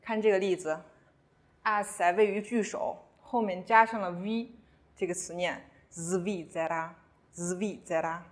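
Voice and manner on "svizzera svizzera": svizzera starting with buzzed zv; voiced s. Shown as a demonstration. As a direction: model svizzera slowly, initial s as z. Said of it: "这个时候s发浊音".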